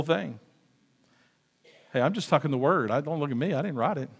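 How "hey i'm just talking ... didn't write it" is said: usual speaking voice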